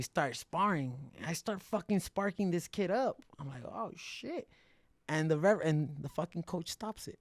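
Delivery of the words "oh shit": high, startled little gasp of a curse; surprised. Retold or imitated. Fighter surprised at beating the prize kid, high startled. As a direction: catch yourself off guard mid-spar.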